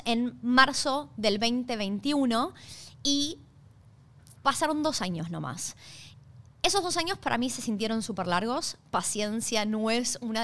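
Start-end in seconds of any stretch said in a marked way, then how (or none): none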